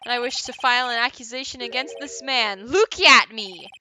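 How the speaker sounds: loud courtroom declaration; forceful accusation. Acted. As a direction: declare it to the whole court, loudly. Acted lawyer's formal accusation.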